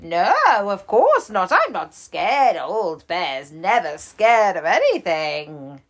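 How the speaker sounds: loud, mock-boastful bear; a bear pretending he's brave; proud old bear.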